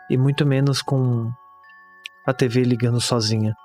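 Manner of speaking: grave, pausada horror narration; narração de conto de terror. Acted. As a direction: narrate a bleak horror tale, measured, with long pauses. (narração sombria de conto de terror)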